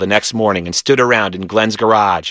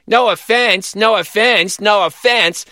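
put-on toddler parroting; 11 semitones higher, slower than usual.

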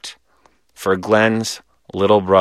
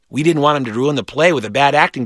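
ordinary delivery

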